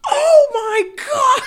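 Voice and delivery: high, loud, slow mock cry of shock; shocked yell. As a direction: shriek it like the joke just landed. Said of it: "Reynolds goes".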